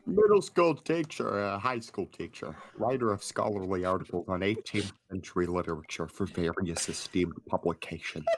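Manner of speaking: a hint of Ken from Bee Movie. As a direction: read the teacher's lines as a mild take on Ken from Bee Movie. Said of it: "You want Ken from the Bee Movie".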